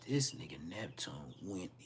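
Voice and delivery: hushed gossip whisper; breathy rumor whisper. Retold or imitated.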